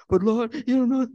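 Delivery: high, loud protesting whine; whining complaint. Acted. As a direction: complain to God like a fretful child. Mock complaint to the Lord.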